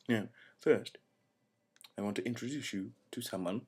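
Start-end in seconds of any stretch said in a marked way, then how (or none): none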